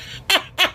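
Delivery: short, high burst of laughter; helpless giggle. Real, not acted. Crafter cracking up at her own video, helpless giggle. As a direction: let out a real high giggle. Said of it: "I can't stop laughing".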